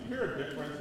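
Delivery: ordinary talk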